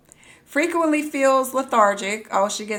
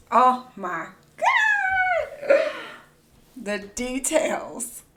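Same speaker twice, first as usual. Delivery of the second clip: very high, slow oh my God; breathless awe, slow and high; awed gasp; 7 semitones higher, much slower than usual. Real, not acted. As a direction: swoon at the details. Awed by description depth.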